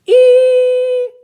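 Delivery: piercing high note like a flute; high musical squeal. Acted.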